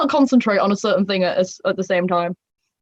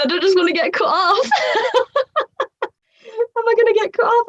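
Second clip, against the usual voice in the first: high, squeaky laughing worry; giggly panic; 14 semitones higher than usual. Real, not acted. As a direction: laugh through the worry, pitch way up. Giggling worry about being cut off.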